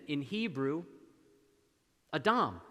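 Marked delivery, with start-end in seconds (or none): none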